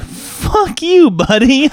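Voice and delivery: high, indignant mock retort; mock outrage. To the game master's email, a high mock outraged retort. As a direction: fire it back, high and offended. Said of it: "I'm like".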